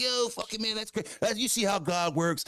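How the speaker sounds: high, stoked skater-bro voice; skateboarder dude. Retold or imitated. Imitating the fan praising God as a high skater dude. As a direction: gush like a hyped skateboard kid. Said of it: "boy talk like he was a fucking skateboarder".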